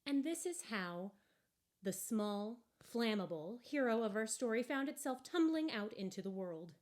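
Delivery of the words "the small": ordinary delivery, nothing marked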